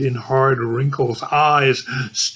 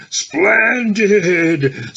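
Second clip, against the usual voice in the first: rich feminine laugh; 5 semitones higher than usual. A narrator's reading. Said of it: "laughs in a rich, feminine key".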